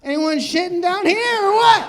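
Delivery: high, loud call from a hiding place; hammed-up peeping prankster; bathroom creep act. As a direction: holler it as a gleeful intruder. Acts creep hiding in bathroom ceiling.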